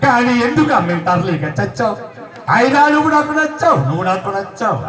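slow, dramatic mock poem recital in Prakash Raj's voice; hammed-up Prakash Raj poetry; Prakash Raj reciting. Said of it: "Prakash Raj గారు అమ్మ మీద చెప్పిన కవిత్వం". (ప్రకాష్ రాజ్ లా నెమ్మదిగా కవిత చెప్పడం)

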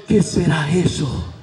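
low, soft wondering; a hint of a puzzled blind beggar; lightly voiced blind man. (ciego desconcertado, apenas actuado)

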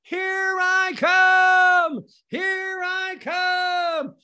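high, long-drawn exultant yell; screaming. Acted. Streaker bursting from the bus and running, screaming. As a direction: holler it, stretching every word. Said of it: "screaming at the top of my lungs".

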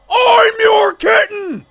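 high, gushing shout; excited fan cry. Acted. Fan answers the streamer's kittens greeting, high excited cry. Fan repeats reply more wildly.

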